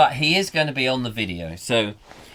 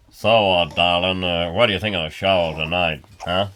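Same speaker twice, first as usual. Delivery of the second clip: drawling mascot; 7 semitones lower than usual. Pretend.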